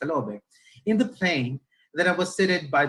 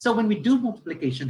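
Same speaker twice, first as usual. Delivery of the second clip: higher-pitched teacher addressing a class; conversational teacher; 5 semitones higher, 3 dB louder than usual. Shown as a demonstration. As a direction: chat to pupils as you introduce the lesson. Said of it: "para ka lang nakipag-usap".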